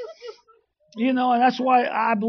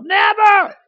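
high, loud burst of "never"; emphatic shout; 8 semitones higher, 10 dB louder than usual. Retold or imitated. Preacher telling a congregation his story, emphatic shout.

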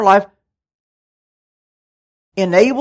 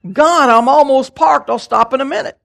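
higher and louder, insisting he's nearly parked; a little louder; 6 semitones higher, 6 dB louder than usual. Retold or imitated. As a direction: call back up to God impatiently. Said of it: "I said a little bit louder back".

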